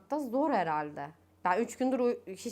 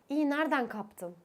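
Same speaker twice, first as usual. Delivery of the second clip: nosy caller; 6 semitones higher than usual. Retold or imitated. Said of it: "Arıyor".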